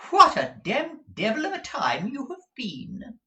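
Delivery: high affected dandy complaining; high-pitched dandy's mock grievance; foppish Mantalini. A narrator's reading. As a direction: fuss like a pampered fop. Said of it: "said Mister Mantalini".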